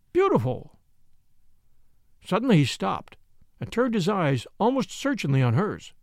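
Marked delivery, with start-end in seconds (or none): 0.1-0.8 higher, rising burst of admiration; admiring exclamation. A narrator's reading. Professor marvelling at the painting, an admiring high exclaim. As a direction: let the praise break out high.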